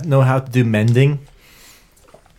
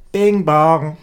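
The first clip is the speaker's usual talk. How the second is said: chime noise; 7 semitones higher, 6 dB louder than usual. Acted. Playful door chime noise.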